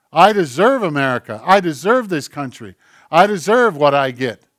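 loud, emphatic claim of entitlement; entitled boast. Acted. Preacher mimics someone acting as though he deserved it, loud and entitled. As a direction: proclaim it loudly, hitting each "deserve".

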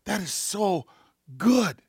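hushed delight rising to "good"; retold delighted amazement. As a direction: savor the discovery, pitch lifted. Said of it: "and I go".

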